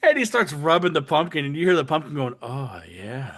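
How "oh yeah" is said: low, soft moaning oh yeah; pleasured moan. Retold or imitated. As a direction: moan it like being rubbed. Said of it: "hit us with the moaning Myers".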